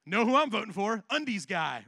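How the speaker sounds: loud, eager cheer for a candidate; excited fan. Retold or imitated. Imagined voter cheering for the undies candidate, loud and eager. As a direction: shout it like a thrilled voter. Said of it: "Some of us would go".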